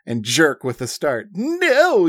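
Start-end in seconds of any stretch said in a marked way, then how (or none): 1.3-2.1 cry out. A narrator's reading. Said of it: "you cry out".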